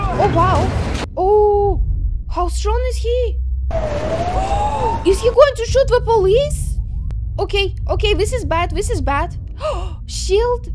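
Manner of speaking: loud, alarmed reaction; shocked and worried; excited. Real, not acted. Reacting to tense movie scene.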